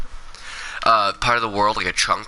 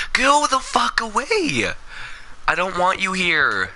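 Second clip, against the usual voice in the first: very high, drawn-out wail at an intruder; exasperated yelling; 11 semitones higher, slower than usual. Real, not acted. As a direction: stretch it out high, then come down a little. Yelling at returning Enderman.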